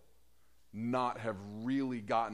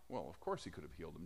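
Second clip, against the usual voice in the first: fast, much softer throwaway; quick aside; 9 semitones lower, 12 dB quieter, much faster than usual. Real, not acted. Preacher answering the mourners, fast and soft. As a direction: toss it off quickly.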